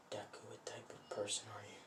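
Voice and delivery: whispered private question; very soft inward musing; hushed wondering. Retold or imitated. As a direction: wonder it under your breath. Iida wonders to himself.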